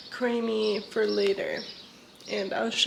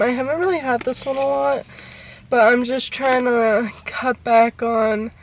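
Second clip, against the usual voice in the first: louder, raised voice over the noise of the gym; talking over gym noise; 8 dB louder than usual. Real, not acted. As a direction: push the voice out so it carries in a busy gym.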